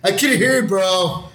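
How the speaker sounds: high-pitched needling jab; mock complaint. Mocking casting reader at audition.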